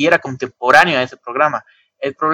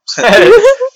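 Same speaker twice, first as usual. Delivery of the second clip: high burst of laughter; 8 dB louder than usual. Real, not acted. Laughs at Pinedo joke.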